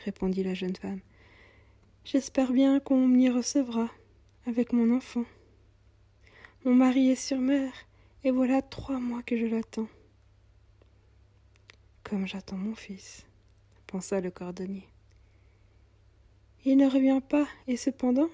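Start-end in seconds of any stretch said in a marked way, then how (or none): 2.0-9.9 put-on higher, plaintive young mother's voice; young mother, weary. Poor young mother telling the cobbler she's going to hospital, higher and plaintive. 12.0-13.3 whispered thought. Acted. Cobbler's private thought. 16.6-18.3 put-on higher, plaintive young mother's voice; young mother, weary. Poor young mother telling the cobbler she's going to hospital, higher and plaintive.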